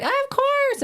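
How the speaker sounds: very high-pitched mock-motherly chirp; naive eager agreement. Daughter retells mom agreeing to a hacked friend's request, naive high chirp. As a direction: imitate a trusting mom, voice way up high.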